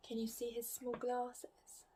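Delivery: soft unvoiced whisper to the listener; hushed, secret aside. A narrator's reading. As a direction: lean in and whisper the question.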